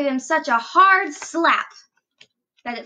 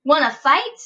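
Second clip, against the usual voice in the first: loud picking a fight; grouchy; 7 dB louder than usual. A narrator's reading. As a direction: taunt him, grumpy and loud. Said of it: "said the grouchy ladybug".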